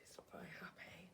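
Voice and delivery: barely voiced hushed aside; whisper. Real, not acted. Whispering to the child that the Lorax is upset. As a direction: whisper it behind the book. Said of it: "whispered aside to listener".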